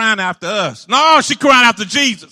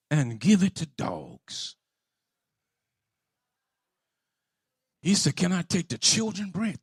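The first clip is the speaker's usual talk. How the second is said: hushed; 7 semitones lower, 13 dB quieter than usual. Acted. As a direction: hush the room to a murmur. Preacher drops to near whisper.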